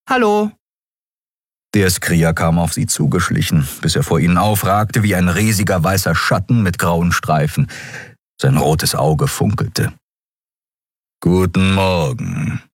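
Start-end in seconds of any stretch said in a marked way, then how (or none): 0.1-0.5 kleines Wesen. Acted. Nelen grüßt als kleine Figur. 11.2-12.6 tief und langsam geknurrt, ohne Miene; knurrender Löwe. A narrator's reading. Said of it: "knurrte er".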